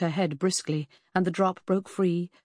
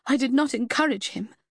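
higher, louder, stung and bitter; bitter retort; 7 semitones higher, 4 dB louder than usual. Acted. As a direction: throw it back at him with bitterness. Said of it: "she retorted bitterly".

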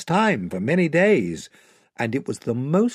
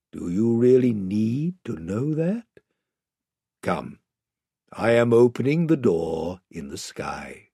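low, calm lion voice; Aslan, calm authority; 3 semitones lower than usual. Acted. Lion calling the children to the door, calm and solemn. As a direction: gently close the matter and summon them.

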